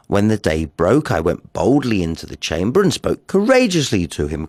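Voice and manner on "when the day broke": ordinary delivery, nothing marked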